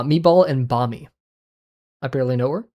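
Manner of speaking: normal talking voice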